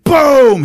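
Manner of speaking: sudden loud boom; high, explosive imitation; blast noise. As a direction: shout the shot as a sound effect. Mimics gunshot blast.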